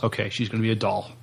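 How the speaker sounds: ordinary delivery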